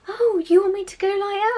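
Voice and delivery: over-the-top sarcastic sweetness; mock surprise. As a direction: sing it out in sarcastic innocence. Mocking faux sweetness to client.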